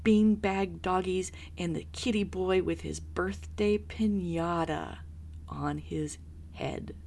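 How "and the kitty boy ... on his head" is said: usual speaking voice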